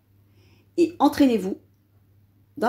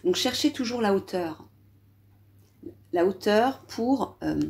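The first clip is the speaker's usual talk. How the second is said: ordinary talk, nothing marked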